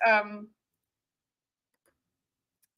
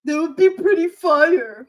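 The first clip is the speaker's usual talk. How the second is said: slow, louder mocking echo of Hassan; 4 semitones higher, 7 dB louder than usual. Teasing Hassan's slang, a slow lightly mocking echo. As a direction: drag it out to poke fun at him. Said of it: "You don't say fire".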